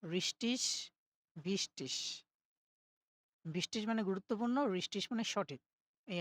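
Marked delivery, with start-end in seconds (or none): 1.4-2.0 ভিস-টিস with a final ish; teacher demos ভিস-টিস ending in ish. Shown as a demonstration. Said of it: "ই আর গে থাকলে ইসের মতো উচ্চারণ হবে".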